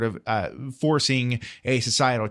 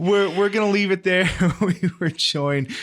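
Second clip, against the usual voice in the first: laughing; 6 semitones higher than usual. Real, not acted. Host cracking up at own joke.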